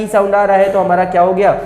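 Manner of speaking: usual speaking voice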